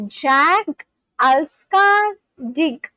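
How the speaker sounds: very slow, drawn out "Jag Alskar Dig", stretching "Alskar"; slow Swedish. Shown as a demonstration. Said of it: "What does that mean?".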